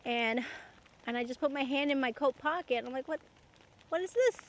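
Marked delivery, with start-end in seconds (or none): 3.1-4.4 sudden discovery, pitch jumps up; puzzled surprise. Retold or imitated. Finds money in her pocket.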